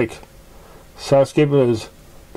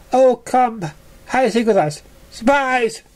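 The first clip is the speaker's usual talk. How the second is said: high, sing-song teasing pony voices; mock taunting ponies; 11 semitones higher than usual. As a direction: tease like playground bullies.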